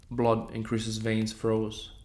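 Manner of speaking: plain reading voice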